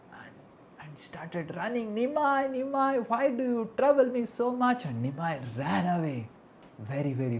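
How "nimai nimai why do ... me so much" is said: put-on high, loud scolding of a child; raised-pitch, pleading telling-off; exasperated mother. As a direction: call after a naughty son in exasperation. Mother chasing her running son.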